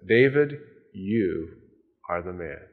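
low, hushed and grave; solemn quiet accusation. Acted. Prophet Nathan quietly accusing King David, low and grave. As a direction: let the verdict drop quietly.

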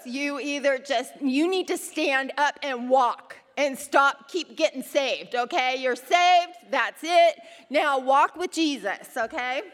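stern fatherly lecture. Retold or imitated. Dad telling son to stop getting saved, firm and loud. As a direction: lay down the law to the boy. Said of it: "his dad had to pull him aside and say".

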